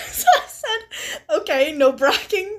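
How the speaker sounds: laughing. Real, not acted. Laughing while reading reply.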